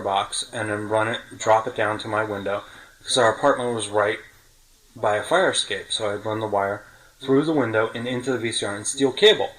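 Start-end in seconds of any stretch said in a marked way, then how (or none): none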